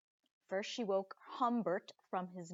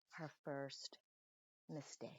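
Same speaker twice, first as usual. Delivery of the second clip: hushed suspense; 4 semitones lower, 10 dB quieter, slower than usual. A narrator's reading. Suspense before mistake.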